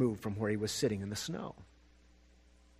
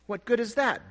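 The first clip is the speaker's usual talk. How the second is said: sniffling; 9 semitones higher than usual. Acted. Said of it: "Barrington sniffed".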